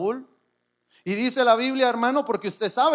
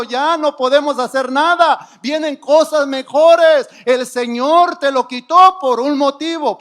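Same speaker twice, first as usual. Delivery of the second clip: loud encouragement; 3 semitones higher, 8 dB louder than usual. Real, not acted. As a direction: call out hope to the person beside you. Preacher voicing encouragement to neighbour. (ánimo a voz en cuello)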